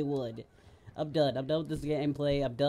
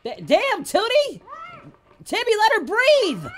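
higher and louder, scandalized shout; shocked yelling; 8 dB louder than usual. Real, not acted. Gamer shouts at characters in a game scene, scandalized and loud. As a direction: yell it out in shock.

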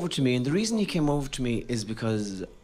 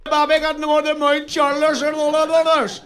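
much higher and louder mock Donegal accent, laughing; thick Donegal accent; 12 semitones higher, 5 dB louder than usual. Comedian mocking his hometown, thick Donegal accent. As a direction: rattle off place names in broad Donegal. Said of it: "That's a Donegal accent".

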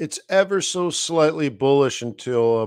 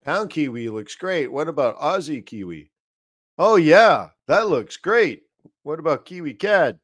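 mock eager trader; 4 semitones higher, 4 dB louder than usual. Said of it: "And you're like".

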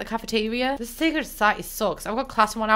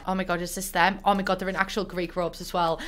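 normal talking voice

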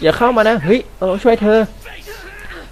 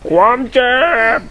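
higher mock monster voice saying ความเจ็บ; put-on game voice; 5 semitones higher than usual. Gamer echoes the boss saying ความเจ็บ, higher mock voice. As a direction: echo the game's pained line, pitched up. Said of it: "ทำไมกูต้องทำเสียงแบบนี้ด้วย".

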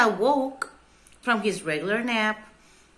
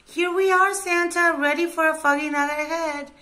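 high, loud eager call; shouted reply; 7 semitones higher, 3 dB louder than usual. A narrator's reading. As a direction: shout back in a raised pitch, quickly. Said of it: "they shouted together".

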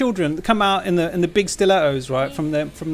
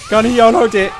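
loud, amused call-out again; laughing loud exclaim; excited exclaim; 5 semitones higher, 9 dB louder than usual. Real, not acted. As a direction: announce it with glee. Repeats the exclamation.

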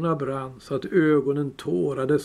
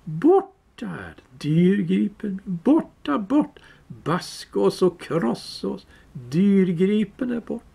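higher, slow creature lament; Gollum wailing; 5 semitones higher than usual. Acted. Gollum mourning his lost precious, high wail. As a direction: wail over the lost treasure.

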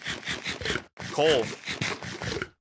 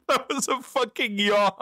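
laughing; 8 semitones higher than usual. Real, not acted. Laughing after his yawn.

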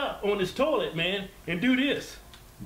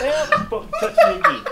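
mock furious woman; 6 dB louder than usual. Acting out mad woman.

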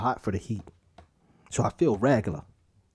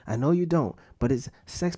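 ordinary delivery

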